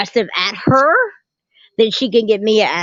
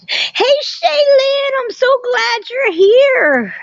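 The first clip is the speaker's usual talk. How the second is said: high, slow, louder squeal of delight; squealing welcome; 3 dB louder, slower than usual. Real, not acted. Welcoming Shaylin to the live after her surgery, a high happy squeal. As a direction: draw out the greeting way up high, overjoyed.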